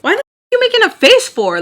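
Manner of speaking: high, loud angry demand; pissed off. Retold or imitated. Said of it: "So I'm pissed".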